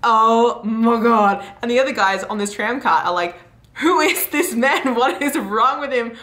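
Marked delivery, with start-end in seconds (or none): 0.0-1.4 slow, loud stunned reaction; mock shock. She hears he is a porn star, exaggerated shock. 3.7-6.2 high, incredulous imitation; mock bewilderment. Other guys react to the stranger's confession, incredulous.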